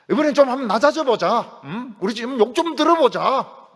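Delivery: rally everyone to take it gladly; eager resolve. Acted. Believers voiced, resolving.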